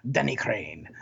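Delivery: imitated Russian accent, laughing. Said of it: "his Russian accent going".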